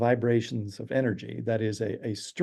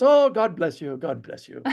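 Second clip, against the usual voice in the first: kindly blessing; 4 semitones higher than usual. Retold or imitated. Storyteller mimics the friend's reaction to rudeness, high kindly blessing that softens. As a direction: imitate a gracious driver blessing someone, starting high. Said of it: "And his reaction was".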